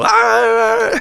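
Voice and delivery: very high, loud whooping scream out a car window; wild scream. Retold or imitated. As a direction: let out a joyful whoop. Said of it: "scream out the window".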